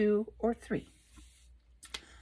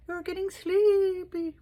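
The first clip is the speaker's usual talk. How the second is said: much higher, slow sing-song hypnotist; hammed-up hypnotist; 10 semitones higher than usual. Repeating the hypnotist line to viewers, higher and hammier. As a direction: push the hypnotist voice up and over the top.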